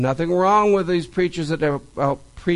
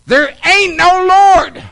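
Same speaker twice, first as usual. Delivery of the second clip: loud, high and slow shout back; frustrated neighbour yelling back; angry shouted retort; 12 semitones higher, 9 dB louder, slower than usual. Retold or imitated. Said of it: "So he would shout out".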